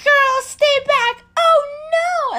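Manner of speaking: high, slow, loud frantic warning; mock panicked mom. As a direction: wail it like a scared mother. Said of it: "she's like".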